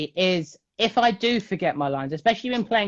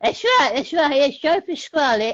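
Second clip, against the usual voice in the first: much higher squeaky Pasquale voice, louder; Joe Pasquale impression; 11 semitones higher, 5 dB louder than usual.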